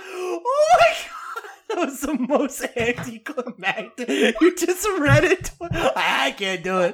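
high voice breaking up with laughter; real helpless laughter. Real, not acted. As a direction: wheeze the words out, cracking up. Cracking up at the failed accent.

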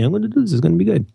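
put-on light Portuguese accent; mock Portuguese accent from a friend. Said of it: "that's my Portuguese accent".

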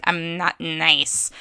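slow cartoon villain quote; drawn-out villainous character voice; Skeletor. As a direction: stretch each word in a put-on villain voice. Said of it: "Skeletor is holding".

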